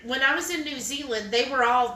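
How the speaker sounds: ordinary talk, nothing marked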